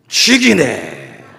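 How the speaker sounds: very slow, low Gyeongsang drawl of 죽이네; Gyeongsang dialect. Retold or imitated. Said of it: "경상도 말로는".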